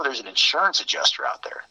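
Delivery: usual speaking voice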